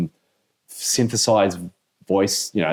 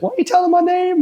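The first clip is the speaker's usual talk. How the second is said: shrill, much higher mock yell of an angry scammer; 6 dB louder than usual. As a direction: squeal it like a furious call-center scammer, louder. Acting out arguing call-center scammer.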